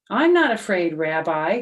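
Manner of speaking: loud, defiant I'm not afraid; bold declaration. Acted. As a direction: stand tall and refuse to be scared. Herschel insists to rabbi.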